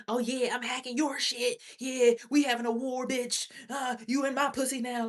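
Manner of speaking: high, boastful mock voice; high-pitched mocking taunt; imitated rivals. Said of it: "people really be sitting here thinking like".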